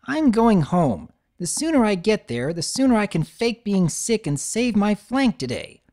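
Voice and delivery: filly sulking off to fake sick; fed-up filly. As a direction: grumble as you stomp away. Scootaloo stomps off.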